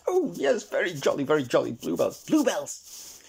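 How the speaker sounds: put-on excited high old squirrel; Mister Squirrel gushing. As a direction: bubble over about bluebells in a high squirrel chatter. Squirrel gushes about bluebells.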